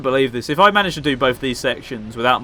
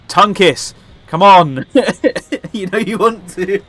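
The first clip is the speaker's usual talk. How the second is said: teasing shout; 5 semitones higher, 7 dB louder than usual. Pretend. Teasing the boss character.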